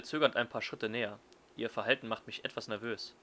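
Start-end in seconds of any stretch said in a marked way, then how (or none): none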